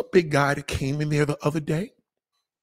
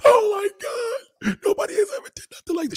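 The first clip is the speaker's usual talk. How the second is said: high, wailing sob; hammed-up tearful gratitude; mock crying. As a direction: blubber like a little kid. Imitating himself crying over gift.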